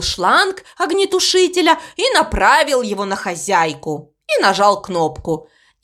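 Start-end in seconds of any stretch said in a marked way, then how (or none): 5.8-5.9 high drawn-out squeal; startled shriek. A narrator's reading. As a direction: squeal in sudden shock. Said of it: "закричала хозяйка". (испуганный визг)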